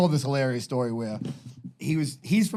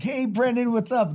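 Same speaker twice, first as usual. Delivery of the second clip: high-pitched impression of an almost intellectual talker; affected, certain way of talking, pitched way up; 10 semitones higher than usual. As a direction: greet him in a put-on, raised voice. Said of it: "I always do this impression of him. He talks, like, in this, like, very certain way".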